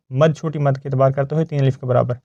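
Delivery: ordinary talk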